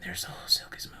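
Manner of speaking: soft breathy whisper; whisper in left ear. Pretend. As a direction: whisper it silky smooth. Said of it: "our voices are pretty silky smooth".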